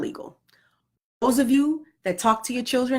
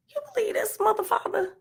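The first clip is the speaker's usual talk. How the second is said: mock outraged squeal; 12 semitones higher, 6 dB quieter than usual. Mom ranting to her husband about the kid, high and incredulous. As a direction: jump way higher, incredulous squeak. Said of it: "I say things like".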